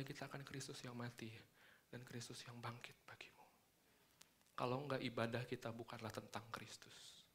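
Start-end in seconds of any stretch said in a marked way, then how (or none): 0.0-3.5 whispered. Acted. Sermon point dropped to whisper.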